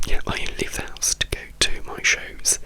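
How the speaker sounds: usual speaking voice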